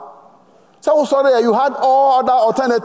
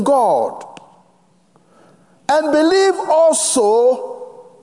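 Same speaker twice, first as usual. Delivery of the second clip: scripture reading. Acted. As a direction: read the verses with solemn weight. Reads scripture in the sermon.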